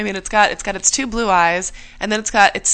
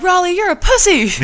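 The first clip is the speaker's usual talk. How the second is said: mock tough soldier; 11 semitones higher, 5 dB louder than usual. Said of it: "they weren't like".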